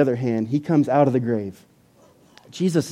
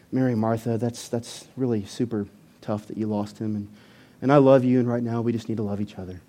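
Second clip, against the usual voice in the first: soft, low, soothing condolence; hushed, overly tender comforting; candy-coated sympathy; 6 semitones lower, 8 dB quieter than usual. Acted. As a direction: console them gently, sugar-coating it. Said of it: "He could have candy-coated it".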